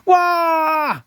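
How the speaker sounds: high loud startled shriek. A narrator's reading. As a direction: scream at the bugs landing on her. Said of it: "shrieked Missus Hindle".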